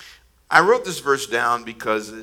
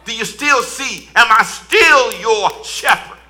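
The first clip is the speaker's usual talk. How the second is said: repeated questions climbing higher and louder; preaching build; 7 semitones higher, 10 dB louder than usual. Acted. Pastor preaches the questions he asked himself, ending in a shout.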